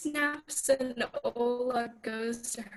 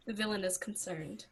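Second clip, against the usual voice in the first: ordinary delivery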